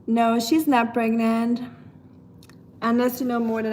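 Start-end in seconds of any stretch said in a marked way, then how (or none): none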